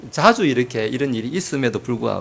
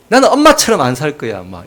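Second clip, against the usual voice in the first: put-on defiant child; 2 semitones higher, 9 dB louder than usual. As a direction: talk back like a sulky teenager. Defiant child shouting at mother.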